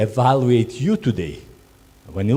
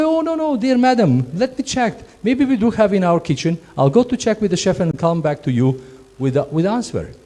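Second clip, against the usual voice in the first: much higher, sweet courteous voice; polite reply; 7 semitones higher than usual. Shown as a demonstration. Crew member answers guest politely, high and sweet. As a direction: sweeten the voice to please madam. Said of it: "you have to say".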